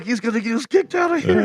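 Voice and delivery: high-pitched comic worry; mock panic. Son fearing dad's remark to nurse, mock panicked. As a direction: retell your rising panic, playing it up.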